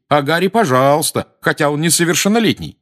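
high, thin, loud mimicry; mock mother voice. A narrator's reading. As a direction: complain in an imitation of mother. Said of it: "тонким голосом, страшно похожим на материнский". (передразнивая мать тонким голосом)

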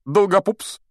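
high, loud call of a pupil's name; firm Lockhart. A narrator's reading. Teacher picking a volunteer from the pupils, firm and high. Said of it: "сказал твёрдым голосом".

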